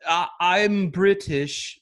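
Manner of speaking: slow, laughing bad British accent. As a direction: drawl I'm British in a hopeless British accent. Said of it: "a horrible impersonation the whole time".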